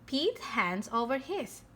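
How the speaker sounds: ordinary delivery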